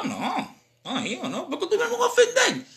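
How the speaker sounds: high laughing protest, amused; amused, laughing disbelief. Real, not acted. Laughing off the idea of offense.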